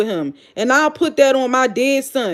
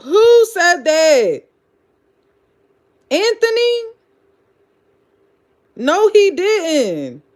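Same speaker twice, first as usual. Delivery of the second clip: incredulous; 6 semitones higher, slower than usual. Real, not acted. Incredulous at a comment.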